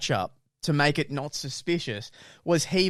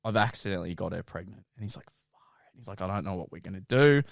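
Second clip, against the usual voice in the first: mock serious, softer confession; soap-opera drama; 10 semitones lower, 6 dB quieter, faster than usual. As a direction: ham it up like a daytime soap. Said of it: "you would think he's on Bold and the Beautiful".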